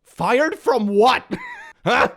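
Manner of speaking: high, slow mock disbelief; incredulous. Real, not acted. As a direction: scoff at the absurdity. Mocking incredulous reaction.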